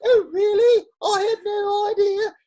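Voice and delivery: very high-pitched, loud mock British accent; horrible British accent. Husband quoting his Brooklyn wife in a horrible British accent. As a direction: put on a terrible, piercing British falsetto. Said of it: "throw on this horrible British accent".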